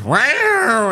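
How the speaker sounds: high, loud meow; imitated cat yowl. Owner imitating his cat demanding treats, loud high yowl. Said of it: "And he goes".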